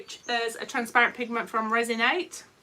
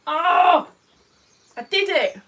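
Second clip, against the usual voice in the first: excited; 4 semitones higher, 9 dB louder than usual. Real, not acted. Cheering after hitting lingo bingo.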